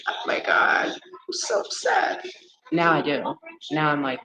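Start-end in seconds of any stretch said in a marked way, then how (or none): 0.1-2.2 high, slow mock sob over a movie; mock upset wail. Imitating herself whining over a sad movie. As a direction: ham up being upset. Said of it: "watch movies and be like".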